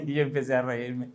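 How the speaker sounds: usual speaking voice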